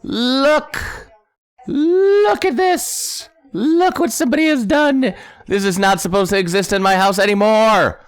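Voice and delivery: squealing, very high, hammed-up indignation; mock outrage. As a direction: shriek it like a scandalized homeowner, way up high. Mock outrage at griefed house.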